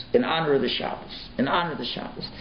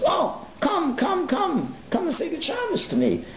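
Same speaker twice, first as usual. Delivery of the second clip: much higher, an eager welcoming call to the back row; warm rebbe calling. Retold or imitated. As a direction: beckon them in high and bright. Rebbe calls the men forward.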